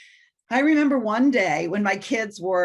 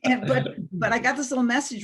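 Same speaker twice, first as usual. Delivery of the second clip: usual speaking voice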